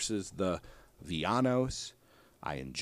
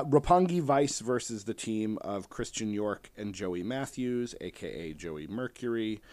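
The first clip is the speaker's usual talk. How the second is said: ordinary talk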